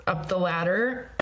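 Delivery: ordinary delivery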